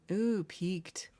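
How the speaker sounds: ordinary talk, nothing marked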